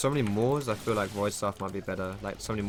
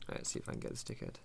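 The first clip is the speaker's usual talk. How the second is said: hushed mutter; 12 dB quieter, faster than usual. Real, not acted. Sneaking up on an enemy.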